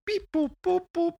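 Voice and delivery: high robotic beeping. Pretend. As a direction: beep like a computer. Typing into computation device.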